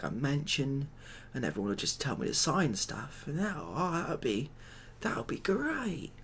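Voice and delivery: usual speaking voice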